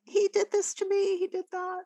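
high whiny little-kid voice imitating a tattling daughter; whiny tattling child. Counsellor imitates the daughter tattling to mom, high whine. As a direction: play the little girl telling on her brother. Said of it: "Does she come running to you?".

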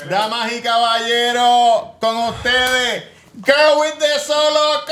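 slow, high sung host intro; grand entrance announcement. Pretend. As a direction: announce like a ring MC.

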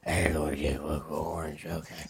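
slow hushed whisper. Retold or imitated. Answering the roommate in a slow secret whisper. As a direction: sneak it out under your breath, slowly. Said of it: "I was actually like".

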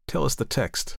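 quiet, sly prod; understated sly request. A narrator's reading. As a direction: tease with feigned innocence. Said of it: "George said slyly".

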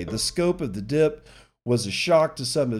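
ordinary delivery

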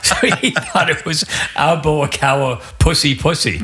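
giggling through the words; words bubbling with laughter; laughing while talking. Real, not acted.

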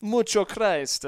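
slow, loud, high hammed-up Italian accent; mock Italian accent. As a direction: stretch it out in a fake Italian accent. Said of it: "Mexican with an Italian accent".

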